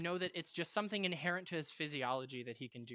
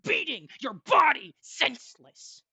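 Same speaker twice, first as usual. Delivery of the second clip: loud, building to high, pounding narration; aggressive; 4 semitones higher, 10 dB louder than usual. Acted. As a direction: hammer each repeated blow harder. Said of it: "You sounded really aggressive".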